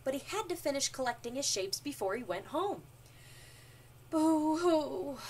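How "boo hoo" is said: higher, louder storybook boo-hoo; hammed-up sobbing word; mock crying. A narrator's reading. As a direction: wail it as a pretend cry. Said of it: "he cried".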